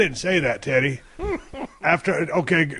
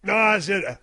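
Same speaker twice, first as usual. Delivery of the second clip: ordinary delivery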